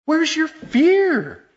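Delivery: very high, loud and slow; pleading cry at a sleeping Jesus; desperate demand. Acted. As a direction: stretch it out in disbelief. Preacher voices the disciples' challenge.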